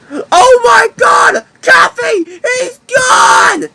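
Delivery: high, shouting alarm; frantic cry at the loss; panicked cry. Acted. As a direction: scream in panic that he's gone. Discovers Cafe kidnapped, panics.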